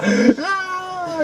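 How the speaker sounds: high, strangled "aah"; mock yell. Swami exploding at the joking devotee, high mock yell. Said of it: "he started yelling at me".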